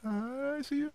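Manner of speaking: high, soft, knowing tease; creepy i see you. Pretend. Host teasing a listening wrestling writer, soft and high. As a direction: let them know you're watching them.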